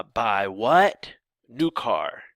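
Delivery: slow "what?" rising, pause, then "New car"; rhetorical question connector. Shown as a demonstration. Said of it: "what" as a connector".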